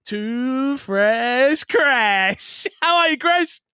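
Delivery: loud, stretched-out name announcement rising high; hyped host introduction. Real, not acted. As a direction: bring on the co-host like a ring announcer.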